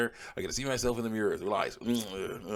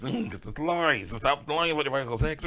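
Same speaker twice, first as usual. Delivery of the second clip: louder, higher mocking laugh through the words; mocking laugh, pitched up and loud; laughing retort; 5 semitones higher, 4 dB louder, slower than usual. Real, not acted. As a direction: laugh it off while jabbing back.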